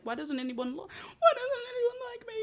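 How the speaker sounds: high, wailing, mock sob; mock crying. Girl bawling at the table, mock sobbing. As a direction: wail it like a hammed-up cry. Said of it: "She's just crying".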